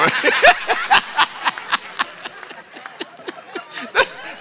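long high laugh out loud; real laughter; high squealing laugh. Real, not acted. Preacher laughing hard.

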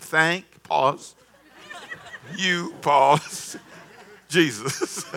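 "Thank" pause "you" pause "Jesus" read through laughter; laughing recital. Retold or imitated. Preacher reading the pastor's text aloud, laughing. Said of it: "He WhatsApp me and said".

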